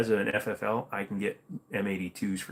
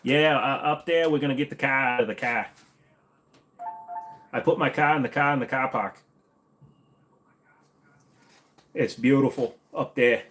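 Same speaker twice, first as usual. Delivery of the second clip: loud, raised-pitch put-on Boston accent; 4 semitones higher, 4 dB louder than usual. Streamer obliging a viewer's request, hammed-up Boston accent. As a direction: ham up a Boston accent, dropping the R's. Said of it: "Say car in your best Boston accent".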